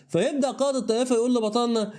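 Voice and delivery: ordinary delivery, nothing marked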